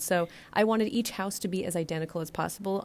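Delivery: ordinary talk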